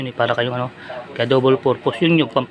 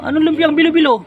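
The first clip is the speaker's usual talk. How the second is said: very high, loud incredulous question; wife's puzzled retort; 7 dB louder than usual. Retold or imitated. The wife asking back, high and incredulous. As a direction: squeak it in disbelief. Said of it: "wife's puzzled reply".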